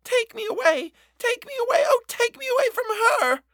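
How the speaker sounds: put-on high wailing child, near screaming; furious wail. A narrator's reading. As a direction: wail to be taken away. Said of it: "an almost furious wail".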